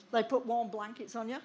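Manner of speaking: ordinary delivery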